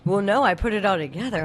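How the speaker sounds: ordinary talk, nothing marked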